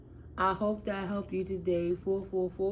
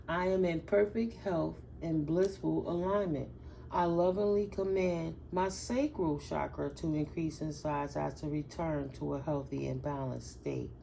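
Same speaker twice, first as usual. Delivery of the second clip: calm, measured affirmation; sincere affirmation, unhurried; affirmation read; 4 semitones lower, 5 dB quieter than usual. Shown as a demonstration. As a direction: recite it evenly like a mantra. Reads affirmation.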